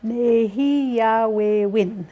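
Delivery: Nehiyawewin slowly, syllables apart; careful slow Nehiyawewin for learners. Shown as a demonstration. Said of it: "syllable by syllable".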